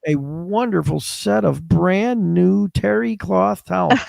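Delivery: slow, high-pitched mock gushing; gushing gift giver. Acting an effusive gift giver.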